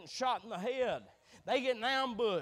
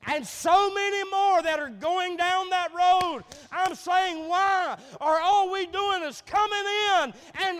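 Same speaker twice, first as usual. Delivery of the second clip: loud, higher, hammering sermon; preaching at full force; 9 semitones higher, 11 dB louder than usual. Acted. Preacher scolding the church for only taking, loud preaching. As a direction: drive it home loudly to the congregation.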